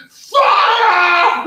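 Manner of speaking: high, loud cry of alarm; shouted alarm. Retold or imitated. Maid spots the kitchen blaze and shouts in alarm. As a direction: yell it out like a warning. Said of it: "someone behind me started shouting".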